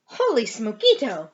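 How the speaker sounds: loud, excited exclamation; exclaiming. A narrator's reading. Kitty boy sees himself in the mirror, exclaiming loudly. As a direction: burst out loudly in amazement. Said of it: "exclaimed Skippy John Jones".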